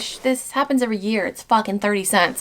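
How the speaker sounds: normal talking voice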